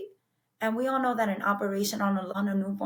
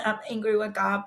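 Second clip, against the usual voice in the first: ordinary talk, nothing marked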